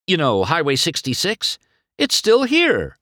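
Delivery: high, eager explanation; lightly acted enthusiasm; excited. A narrator's reading. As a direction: share a discovery, pitch climbing. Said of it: "McQueen explained excitedly".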